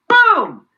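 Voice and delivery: high, loud shouted boom; punchy cartoon explosion; mock explosion boom. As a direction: blurt it like a blast going off. Chain of infection spreading.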